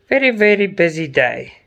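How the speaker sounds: ordinary talk, nothing marked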